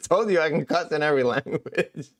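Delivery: laughing through the words. Real, not acted. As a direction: crack up while bragging. Laughing after the Russian cussing.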